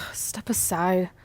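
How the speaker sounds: sighing whispered aside. Real, not acted. Friend taking over the stuck lock, sighing whisper. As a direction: breathe it out in a whisper. Takes over opening the locker.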